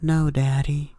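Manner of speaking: put-on high small child voice; little daughter. Daughter refusing to climb into bed, small high voice.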